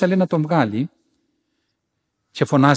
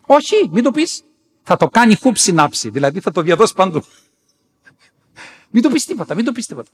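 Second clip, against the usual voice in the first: high-pitched, forceful imitation of forbidding; urgent refusal; 6 semitones higher, 4 dB louder than usual. Elder forbidding the nun to tell, urgent. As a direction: insist anxiously, voice raised. Elder insists nothing be told.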